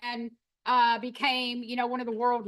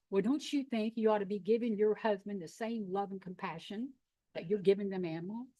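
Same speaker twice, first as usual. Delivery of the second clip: gentle rebuke; 5 semitones lower, 5 dB quieter than usual. Retold or imitated. Howard gently rebukes her.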